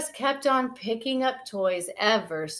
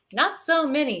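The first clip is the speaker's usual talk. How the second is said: protesting; 6 semitones higher than usual. Acted. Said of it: "she protested".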